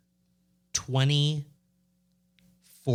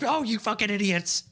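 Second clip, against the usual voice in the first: mock angry yell; 9 semitones higher than usual. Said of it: "be like".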